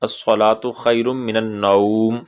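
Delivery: slow, careful अस्सलातु खैरुम मिनन नौम; slow recitation. Shown as a demonstration. Teacher reciting the adhan phrase slowly. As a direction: recite it slowly, every sound clear. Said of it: "ये एक जुमला".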